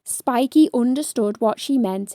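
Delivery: ordinary delivery, nothing marked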